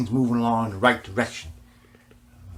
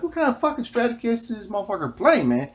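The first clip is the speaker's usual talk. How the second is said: imagined fan voice; 9 semitones higher than usual. Retold or imitated. Imagined fans wondering.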